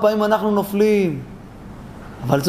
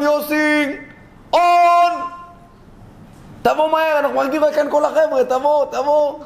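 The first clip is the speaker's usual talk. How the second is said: high, carrying call to a friend; 7 semitones higher than usual. Acted. The gang waiting for him at the window, calling out. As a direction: holler for him to hurry, pitched high. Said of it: "צעק לו מהחלון".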